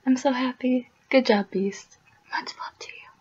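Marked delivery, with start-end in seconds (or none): 2.3-3.1 a soft, whispered send-off; whispered love. Real, not acted. Sending love to Beast, a whispered tender farewell. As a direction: breathe the love out gently.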